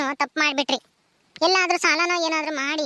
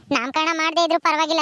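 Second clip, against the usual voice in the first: ordinary talk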